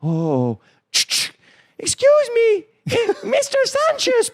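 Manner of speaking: very high squeaky falsetto. Retold or imitated. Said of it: "this is what would happen".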